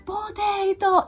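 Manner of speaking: high, very slow, loud called-out name; kids shouting her name. Retold or imitated. Pupils shout the teacher's name in the corridor, high drawn-out call. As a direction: call across the hallway like excited kids. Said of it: "se ponen a gritar mi nombre".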